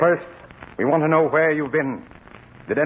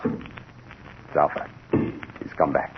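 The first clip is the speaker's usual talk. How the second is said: slow, unvoiced frightened whisper; hushed fear; 9 semitones lower, 5 dB quieter, much slower than usual. Whispering to the doctor that the robot has returned, hushed and afraid. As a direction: whisper it as if the robot could hear. Robot heard entering the house.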